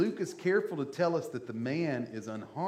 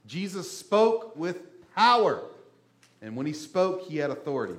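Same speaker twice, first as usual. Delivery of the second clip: normal talking voice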